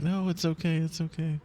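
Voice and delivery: mother comforting her crying daughter; soothing mom. Retold or imitated. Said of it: "And her mom's like".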